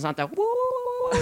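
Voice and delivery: high, falsetto woo-woo whoop; hammed-up high war-cry whoops; mock war whoop. Mock war cry after naming Indians.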